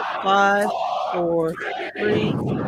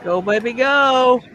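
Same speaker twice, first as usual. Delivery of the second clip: excited cheering; 3 semitones higher, 5 dB louder, much slower than usual. Real, not acted. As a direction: urge the rocket up, drawn out. Cheering the rocket on.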